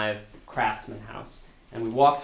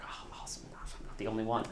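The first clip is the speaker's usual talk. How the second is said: sarcastic aside; 2 semitones lower, 13 dB quieter than usual. Retold or imitated. Sarcastic aside about shared troubles.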